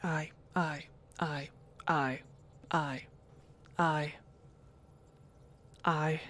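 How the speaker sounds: low, soft Scottish aye repeated. Retold or imitated. Glasgow streamer softly echoing the character's Scottish aye. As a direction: lightly mimic a Scottish aye, trailing off.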